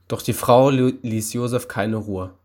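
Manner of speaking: plain reading voice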